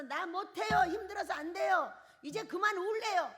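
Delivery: high, loud complaining plea; whining quitter. Acted. Preacher acting out a servant refusing God, high whiny complaint. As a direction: whine like a worn-out servant giving up. Voicing a disobedient, whining self.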